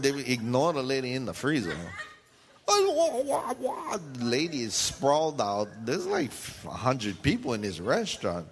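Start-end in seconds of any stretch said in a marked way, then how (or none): 2.7-4.0 high mock whining; mock wailing. Mocking people fussing, high mock whine.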